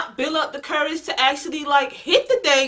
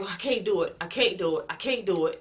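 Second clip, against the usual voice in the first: much softer, repeating it to herself in dread; fearful giving up; 5 semitones lower, 8 dB quieter than usual. Retold or imitated. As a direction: lose your nerve, half under your breath. Too scared to hit lizard.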